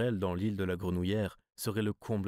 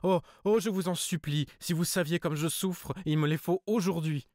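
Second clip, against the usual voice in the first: high, loud, tearful pleading; tearful begging; 9 semitones higher, 4 dB louder than usual. A narrator's reading. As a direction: weep and beg him, voice pitched high. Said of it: "Elle pleurait et bégayait".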